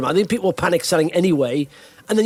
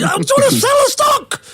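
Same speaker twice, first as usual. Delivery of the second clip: much higher squealing demand; frantic shout. Pretend. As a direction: yell it like a panicking seller on hold. Frantic seller shouting, far.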